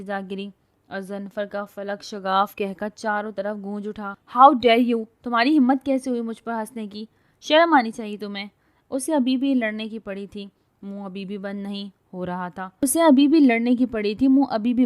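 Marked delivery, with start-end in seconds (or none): 4.4-8.5 loud furious yelling; angry outburst. A narrator's reading. Fallen girl yells at the laughing man, furious. 12.8-14.9 narration read louder, bhi lifted; louder retake. A narrator's reading. Narrator rereads the line louder, stressing بھی. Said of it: "repeated narration line, louder take".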